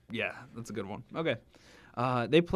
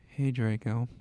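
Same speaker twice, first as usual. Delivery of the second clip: a touch of gloom, lower and subdued; depressing voice; 3 semitones lower than usual. Acted. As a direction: say hello like nothing matters, mildly glum. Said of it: "I said in a depressing voice".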